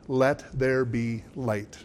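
soft, very slow, measured utterance; quiet, unhurried voice of the Creator; God speaking. Acted. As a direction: say it hushed and deliberate, word by word. Quotes God's words at creation.

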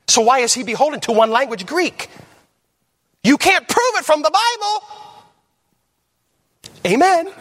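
high-pitched, impassioned pulpit cry; full-force preaching. Real, not acted.

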